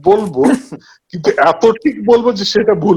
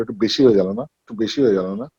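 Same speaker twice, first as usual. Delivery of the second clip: softer, lower worried question after each shot; anxious quiet asking; 7 semitones lower, 5 dB quieter, faster than usual. Retold or imitated. Actor asks the writer after a shot, softly worried. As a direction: quietly check if it was too much. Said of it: "ভয়টা কিন্তু আমার থেকেও বেশি ছিল".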